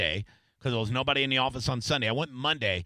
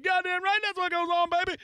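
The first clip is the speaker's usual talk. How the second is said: much higher, smug gloating; gloating friend. Retold or imitated. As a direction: crow like he's enjoying it. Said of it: "He's like".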